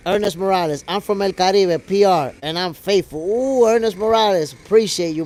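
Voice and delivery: ordinary delivery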